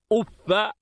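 أف said "uffa", short a after doubled f; fatha ending. Shown as a demonstration. Teacher contrasting forms for students, أف as "uffa". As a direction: end أف on an open "-fa". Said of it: "جعله بمنزلة مد".

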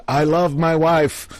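loud, high, drawn-out declaration. Retold or imitated. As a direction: announce it slowly for all to hear. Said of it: "say out loud".

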